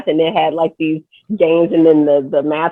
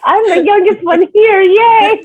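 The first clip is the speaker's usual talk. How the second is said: mock cheer; 14 semitones higher, 5 dB louder, slower than usual.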